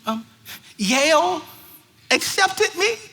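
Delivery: high, hesitant imitated girl; mock nervous teen voice. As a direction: stammer toward the news timidly. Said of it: "And she said".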